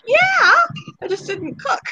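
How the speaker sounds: high-pitched retort; mock defensive answer. Wife admitting she ate without cooking, high mock defensive. Retelling her answer to husband.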